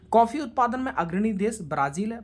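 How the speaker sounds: ordinary talk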